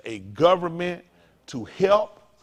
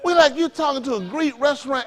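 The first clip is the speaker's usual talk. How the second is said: ordinary delivery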